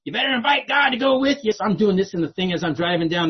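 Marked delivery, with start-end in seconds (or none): 0.0-1.5 much higher-pitched husband in the background; husband calling out. Retold or imitated. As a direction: pipe up high, urging from behind the caller. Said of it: "hear her husband in the phone going".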